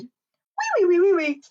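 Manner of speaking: very high, loud piggy squeal; piggy's playful cry. Pretend. Presenter acting the last little piggy for children, playful squeal. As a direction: squeal like a little pig running off. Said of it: "this little piggy cried".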